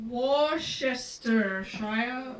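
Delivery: Worcestershire very slowly as wor-SHESS-ter-shire; hesitant pronunciation attempt. Shown as a demonstration. Australian put on the spot, Worcestershire slowly as wor-shess-ter-shire. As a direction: draw out Worcestershire, sounding it out in pieces. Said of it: "You say this word".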